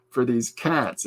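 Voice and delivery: normal talking voice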